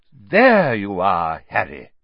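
slow, low, measured official; a hint of a weighty minister; slow low Fudge voice. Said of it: "said a voice".

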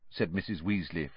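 ordinary delivery

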